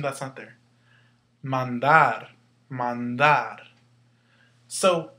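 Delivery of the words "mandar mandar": "Mandar" with the second syllable, DAR, punched louder; stress on final syllable. Shown as a demonstration. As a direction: lean firmly on the last syllable of "Mandar". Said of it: "where you hear the stress".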